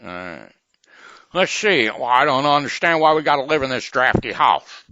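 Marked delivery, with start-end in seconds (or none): 1.3-4.8 high, loud put-on character voice; shrill-free high loud quoting of a film grump; movie quote voice. Host doing a loud high film line.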